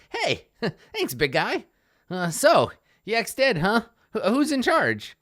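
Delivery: high, jumpy goblin voice; high, chatty little goblin, pitch leaping about; scrappy goblin. Acted. Freed goblin sizing up her rescuer, high put-on voice.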